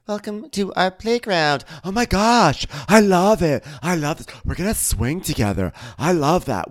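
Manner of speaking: high, loud gushing Whitney voice. Retold or imitated. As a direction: gush over everything like Whitney. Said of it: "And Whitney's like".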